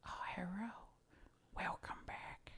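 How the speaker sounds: put-on soft whispered Chinese accent, high; Ting Ting ASMR whisper. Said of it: "She goes".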